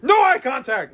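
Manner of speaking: high, loud mock order; domineering. Tyrant clerk warns customer, high and domineering. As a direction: lay down the rule like a tyrant.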